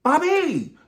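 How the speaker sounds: high, loud call of Bobby; shrieking call. Pretend. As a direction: belt out the name Bobby. Calls out the name.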